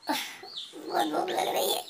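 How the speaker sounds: slow, whispered hungry whine; cat complaining she is starving; whiny hunger. Acted.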